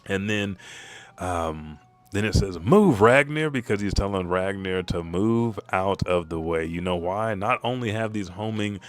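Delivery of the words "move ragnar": loud, high shouted order; urgent warning. Acted. Loki warning Ragnar of flames, urgent. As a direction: shout to get a friend clear.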